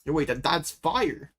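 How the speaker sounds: ordinary talk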